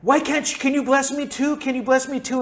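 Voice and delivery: whiny pleading. Retold or imitated. Angry Esau begging his father for a blessing, high whine. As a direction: beg like a cheated kid. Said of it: "and now he's like".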